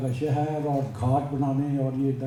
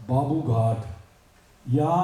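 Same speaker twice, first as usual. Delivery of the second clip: ordinary delivery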